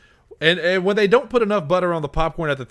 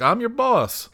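incredulous; 8 semitones higher, slower than usual. Retold or imitated. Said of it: "and I'm like".

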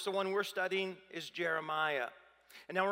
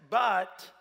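"But" hit loud and sharp; stressed "But"; 3 semitones higher, 7 dB louder than usual. Shown as a demonstration. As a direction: punch the word "But". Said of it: "you might circle that word".

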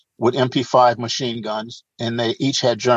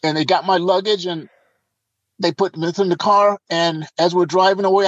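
ordinary talk, nothing marked

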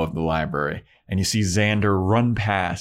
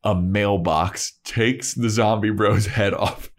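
ordinary talk, nothing marked

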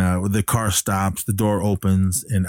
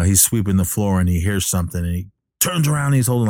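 normal talking voice

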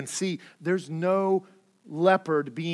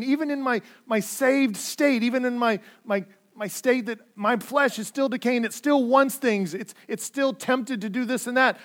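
raised, louder preaching push; fervent preaching; 6 semitones higher, 5 dB louder than usual. Acted. Pastor urging his congregation, fervent and raised. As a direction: preach it with building urgency. Preacher building to full force.

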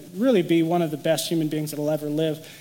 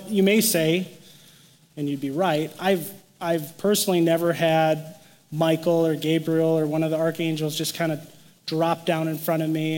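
ordinary talk, nothing marked

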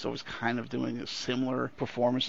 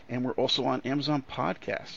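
ordinary talk

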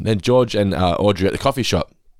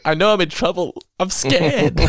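mock scared George; 10 semitones higher than usual. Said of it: "It's like".

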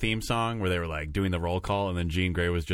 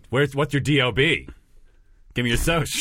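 officious clerk; 5 semitones higher, 4 dB louder than usual. Acted. Mock bureaucrat demanding ID.

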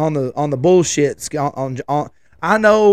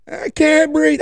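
put-on high, whining kid voice, slowing at the end; whiny kid complaint; 14 semitones higher, slower than usual. Son fussing in the car seat to dad, high whiny kid voice. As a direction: whine like a boy claiming he can't breathe.

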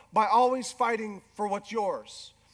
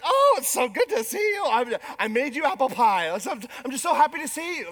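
hammed-up sweet wife, higher and louder; mock sugary sweetness; 3 semitones higher, 5 dB louder than usual. Wife greets husband home with hammed-up fake sweetness, higher and louder. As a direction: fake cheer to mask a grudge. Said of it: "we're just, like".

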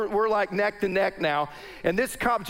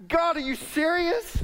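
exasperated disbelief; 9 semitones higher than usual. Retold or imitated. Flat tires after praying.

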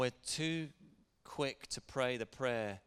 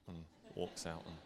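ordinary talk, nothing marked

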